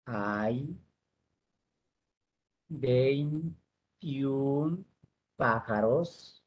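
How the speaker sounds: one word at a time, long pauses; word-by-word dictation. Shown as a demonstration. Teacher dictating a sentence to the class, word by word. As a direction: dictate slowly, stretching each word.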